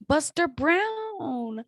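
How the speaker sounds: dreamy savoured name; fond drawn-out repeat. Real, not acted. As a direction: stretch "Brown" out with fondness.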